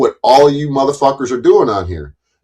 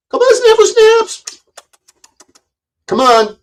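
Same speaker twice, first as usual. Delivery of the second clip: sing-song falsetto taunt, much higher and slow; mocking taunt. Real, not acted. To rival Snafu Snaps, baiting him: high mocking sing-song. Taunting a critic to come over.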